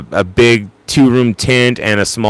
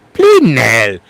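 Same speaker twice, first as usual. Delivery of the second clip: imitated British exclamation; British accent. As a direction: quote it in a put-on British accent. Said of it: "Or as Tanzan likes to say".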